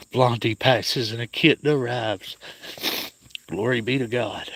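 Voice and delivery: real awed joy; joyful wonder. Real, not acted. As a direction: marvel at it like a small miracle. Grief then a new kitten.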